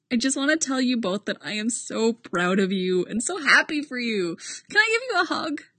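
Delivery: high, effusive, overjoyed voice; gushing sentimental friend. Acted. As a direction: gush like a proud friend. Boyle gushing to the couple.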